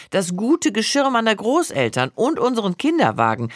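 plain reading voice

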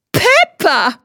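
put-on high, thrilled little girl shrieking the name; high girlish shriek of joy; delighted squeal. A narrator's reading. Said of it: "kreischt Pia entzückt".

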